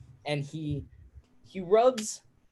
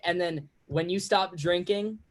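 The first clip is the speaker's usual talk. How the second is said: ordinary delivery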